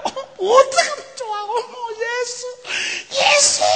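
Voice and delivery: high, wailing mock sob; frantic mother crying out her son's name. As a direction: wail for the lost son in a high voice. Mary voiced crying for Jesus.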